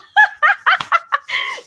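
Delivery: high burst of real laughter after misreading a name. Real, not acted. Host laughing at her own Dorito slip, laughing. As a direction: crack up at your own mistake.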